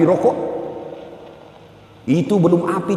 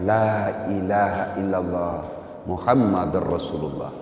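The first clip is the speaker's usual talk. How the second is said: lower, softer, slow strained words; dying struggle; 7 semitones lower, 6 dB quieter, slower than usual. Acted. Alqamah on his deathbed struggling to say the creed, weak and dying. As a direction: force out the last breath. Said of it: "dengan susah payah mengucapkan".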